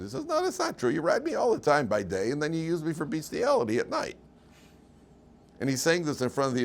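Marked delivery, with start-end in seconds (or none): 0.2-4.2 higher, indignant donkey voice; a lightly voiced donkey, outraged; donkey accusing. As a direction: call him out like a betrayed donkey.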